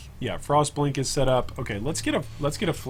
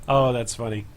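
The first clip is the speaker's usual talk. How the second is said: ordinary talk, nothing marked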